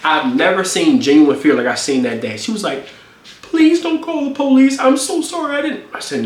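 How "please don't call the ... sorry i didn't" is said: mock frightened pleading. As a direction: mimic her scared pleading. Imitating girlfriend begging in fear.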